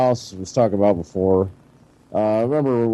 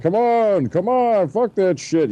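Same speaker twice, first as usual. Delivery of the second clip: pitched-up mock impatience; impatient dad; 8 semitones higher, slower than usual. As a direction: hurry her along like a fed-up dad, retold. Hungry dad urging mom, retold.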